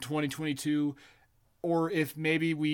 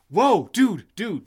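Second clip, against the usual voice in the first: a louder burst of a new idea; sudden excitement; 4 semitones higher, 5 dB louder, slower than usual. Real, not acted. Host struck by a podcast idea, excited blurt.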